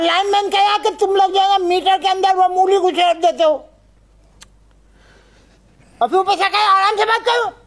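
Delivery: high-pitched indignant rant; venting anger. Acted. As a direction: let the grievance out in high-pitched outrage. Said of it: "मुझ पे अपना गुस्सा क्यों उतार". (ऊँची आवाज़ में ग़ुस्सा उतारते हुए)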